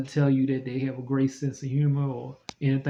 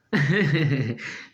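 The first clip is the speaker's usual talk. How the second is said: laughing. Real, not acted. Laughs at own prayer.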